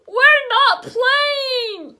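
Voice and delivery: high, loud, slow tearful scream. A narrator's reading. Teen answering Mrs Tubman, loud tearful scream. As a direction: scream it in frustrated panic. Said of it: "Libby screamed".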